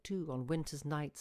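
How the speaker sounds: plain reading voice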